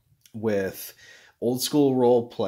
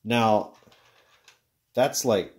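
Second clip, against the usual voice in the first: ordinary talk, nothing marked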